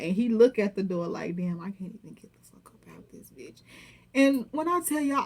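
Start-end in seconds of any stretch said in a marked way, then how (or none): 1.3-3.6 muttered aside. Retold or imitated. Man locked in the car with a bounty hunter, imitated muttering. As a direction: say it under your breath, as his private reaction.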